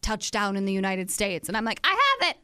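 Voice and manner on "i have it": high, loud mock panic; hammed-up panicked squeak. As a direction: squeal it like you just caught it. Panicked self-quote about virus.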